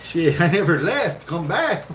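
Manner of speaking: laughing through the words; cracking up at his own punchline. Real, not acted. Storyteller to friends, laughing as he says it.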